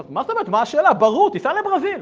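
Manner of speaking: high, loud and insistent; emphatic acted reply. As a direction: toss back the obvious answer loudly. Acted brisk reply in dialogue.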